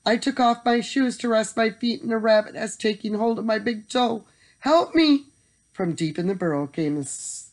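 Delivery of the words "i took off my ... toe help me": little man's high, urgent plea; distressed cry. A narrator's reading. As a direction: explain your plight, then cry out for help. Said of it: "cried the little man".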